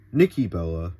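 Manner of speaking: normal talking voice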